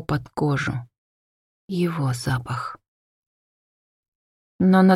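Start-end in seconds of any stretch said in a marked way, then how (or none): 1.7-2.8 slow soft whisper on "Его запах". A narrator's reading. Hanna recalling the man's scent, whispered slowly. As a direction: breathe "Его запах" like a secret. Narrator dwells on his scent.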